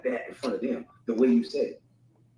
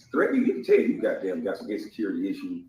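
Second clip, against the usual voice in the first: ordinary talk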